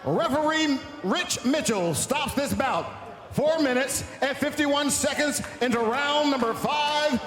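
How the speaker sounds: ordinary talk, nothing marked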